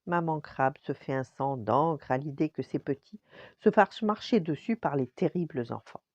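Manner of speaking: usual speaking voice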